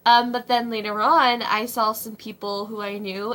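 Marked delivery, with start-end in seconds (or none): none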